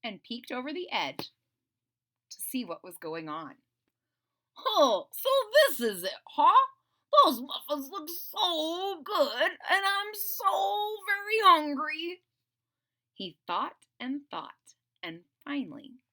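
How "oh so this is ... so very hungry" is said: high, slow, pleading dragon voice; whiny hungry dragon. Acted. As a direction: moan with longing at the muffins.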